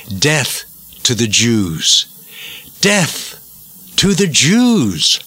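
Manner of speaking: slow mock chant, half shouted; retold shout. Host quoting extremists with their flag, slow half-shouted chant. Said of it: "those extremists who carry it high shout".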